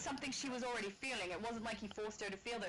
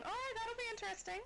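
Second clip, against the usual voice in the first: excited; 12 semitones higher than usual. Real, not acted. Re-says it with sudden interest.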